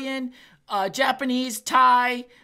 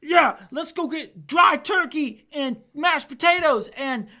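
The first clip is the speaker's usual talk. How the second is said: higher, louder, slow sarcastic cheer; mock enthusiasm; 6 semitones higher, 7 dB louder, slower than usual. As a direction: ham up fake excitement about dry turkey. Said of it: "I'm not looking like".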